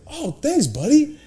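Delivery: a hint of Brody, upbeat and friendly; imitating Brody.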